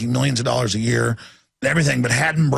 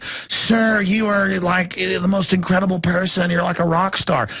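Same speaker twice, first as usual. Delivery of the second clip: high gushing flattery; fawning admirer; 5 semitones higher, slower than usual. Retold or imitated. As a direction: gush over him like a fan. Sycophantic senators praising.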